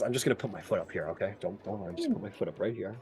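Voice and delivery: low, soft, fast reassurance; reassuring hush. Acted. Assistant reassuring a patient before yanking, hushed and low. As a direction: hush him while bracing to yank. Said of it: "He goes".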